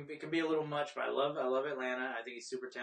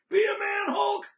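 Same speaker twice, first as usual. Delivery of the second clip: high, loud raspy shout; 10 dB louder, slower than usual. Retold or imitated. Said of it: "[name]'s diss rap track".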